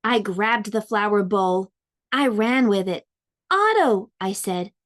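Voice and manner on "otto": calling out. A narrator's reading. Calls to the growing fish.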